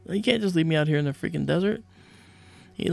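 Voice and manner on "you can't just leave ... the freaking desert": high, fast, half-laughing plea; retold panic, pitched up and rushed; alarmed protest. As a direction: urgently beg not to be left behind. Left alone in the desert, protesting.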